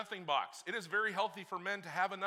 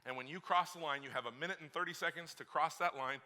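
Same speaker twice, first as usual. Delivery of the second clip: ordinary talk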